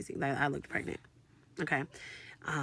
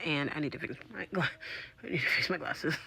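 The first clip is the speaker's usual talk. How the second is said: ordinary talk, nothing marked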